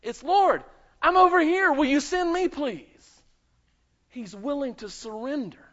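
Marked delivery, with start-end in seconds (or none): 0.2-3.2 much higher and louder, pleading to be picked; eager emphatic plea. Shown as a demonstration. Isaiah begging the Lord to send him, eager shout. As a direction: call out like a child waving for attention. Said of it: "Hene is always used in the emphatic".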